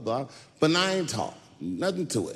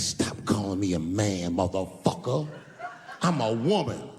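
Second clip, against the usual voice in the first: much lower, gruff indignant; deep offended voice; 9 semitones lower than usual. Retold or imitated. Woman telling the comedian she is a woman, low voice. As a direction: correct him, offended and low. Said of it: "She said".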